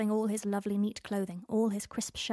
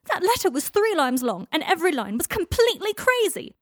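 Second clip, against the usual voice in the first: loud, high furious bawl; 9 semitones higher, 10 dB louder than usual. A narrator's reading. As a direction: bellow it in a rage. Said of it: "Draco bawled".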